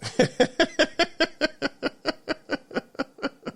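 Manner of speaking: long, soft, high giggle; long high laugh. Real, not acted. Vlogger laughing that young people moved back home. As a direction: laugh at the irony.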